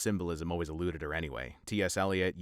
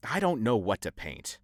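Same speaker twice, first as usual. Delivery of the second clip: usual speaking voice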